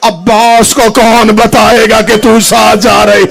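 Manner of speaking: slow, rising to loud wailing shout; weeping, building to a cry. A narrator's reading. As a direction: grow slowly into a cry. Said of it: "Hussain रो कहते". (धीमे-धीमे ऊंची रोती पुकार तक)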